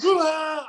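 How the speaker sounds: very high ecstatic "Rah!" yell; joyful roar. Retold or imitated. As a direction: scream with delight as the penalty goes in. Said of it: "and I went".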